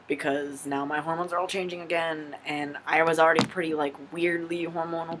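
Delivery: normal talking voice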